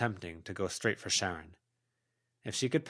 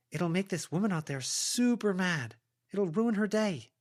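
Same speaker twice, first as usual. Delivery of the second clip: higher, coaxing character voice; heroine coaxing a goblin; 11 semitones higher than usual. A narrator's reading. Heroine persuading a goblin to hunt down traps, coaxing. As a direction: sweet-talk the goblin into helping.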